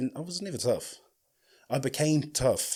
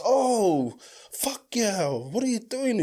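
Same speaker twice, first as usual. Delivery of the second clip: high startled yelp of alarm; 7 semitones higher, much slower than usual. Retold or imitated. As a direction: pitch up in mock alarm. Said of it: "And I'm just".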